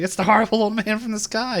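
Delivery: high, loud mock panic; freaking out. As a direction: imitate a teen raising the alarm. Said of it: "everyone begins freaking out".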